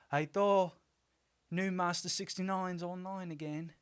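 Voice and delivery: put-on high, even Kiwi accent; Korg. Fan doing the line from Endgame, Kiwi accent. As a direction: put on a high New Zealand voice. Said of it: "try to do the line from Endgame".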